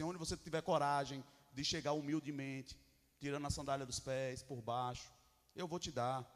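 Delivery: low, soft voice of God; hushed, low divine promise; God's gentle promise. Acted.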